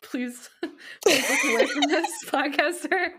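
high, loud plea through laughter; laughing plea. Real, not acted. Podcaster begging a listener to look away, laughing. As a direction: beg playfully while cracking up.

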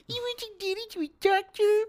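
very high, squeaky mock of a wife's plea; exaggerated sing-song falsetto, jeering; mocking mimicry. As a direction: parrot the words back in a cruel falsetto. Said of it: "he, like, mocks her".

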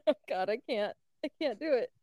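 usual speaking voice

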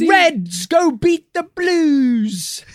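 high, loud, slow hammy Manchester football fan; bad Manchester accent. Football fan cheering for the Reds, loud bad Manchester accent. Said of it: "these horrible accents".

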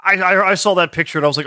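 ordinary delivery

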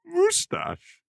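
"Moustache" squealed very high as "moo-stash"; mocking pronunciation. Mocking repeat while laughing.

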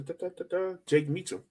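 ordinary talk

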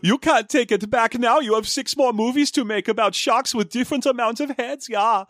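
put-on high, insistent comic German accent; hammy German, ending on a "ja". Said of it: "try out my German accent".

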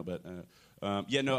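normal talking voice

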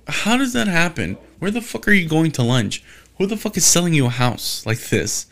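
high, baffled rant at a friend; incredulous outburst. Retold or imitated. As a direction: ask it like you can't believe your ears. Reacting to friends' posts.

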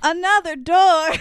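exaggerated echo. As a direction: stretch it out and push the pitch up. Repeats it again, more exaggerated.

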